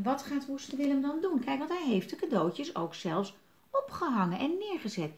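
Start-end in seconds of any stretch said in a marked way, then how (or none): none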